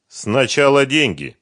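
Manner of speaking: firm, loud and drawn-out demand; decisive. A narrator's reading. Сухарев to Фёдор, bargaining for money, decisive. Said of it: "решительно сказал Сухарев".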